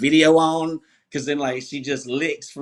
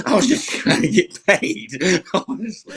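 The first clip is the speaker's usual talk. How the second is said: laughing confession; 4 semitones higher, 4 dB louder than usual. Retold or imitated. Comic admits he just wanted money.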